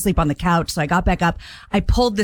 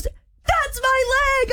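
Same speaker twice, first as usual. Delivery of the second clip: mock pained scream; much slower than usual. Caregiver retelling his cry as the charger hits, mock scream. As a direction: imitate him screaming in pain without full volume. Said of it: "he starts screaming".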